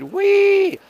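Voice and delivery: a sudden high sledding whoop; gleeful whee. Acted. Streamer recalls his sledding for viewers with a high gleeful whee. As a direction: squeal it like flying downhill on a sled. Playful sledding cry again.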